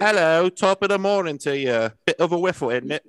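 high, hammed-up posh British accent; mock posh British. Mocking a podcast guest with a posh British accent. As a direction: put on a plummy British voice. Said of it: "sounded cultured British".

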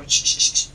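unvoiced shh to hush someone; a manager shushes the employee before explaining; shushing. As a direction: hush him quickly, no voice. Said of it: "Y me dijo".

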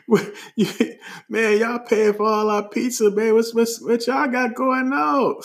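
high-pitched impressed questioning; amazed strangers. Retold or imitated. Strangers asking who paid for pizza, high amazed voice.

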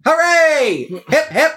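high, loud hip hip hooray; mock cheer. British crowd cheering the new king, mock high chant. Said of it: "and they go".

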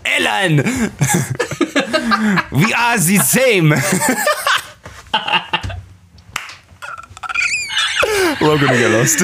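high dictator voice through laughter; Hitler voice. Acted. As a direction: laugh it out in character. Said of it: "Hey, Adolf!".

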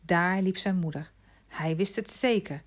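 ordinary delivery

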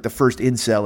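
ordinary delivery

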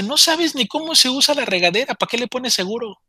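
high-pitched retold scolding; mock exasperated outburst. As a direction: scold her from outside the door, pitch up. Scolding through locked bathroom door.

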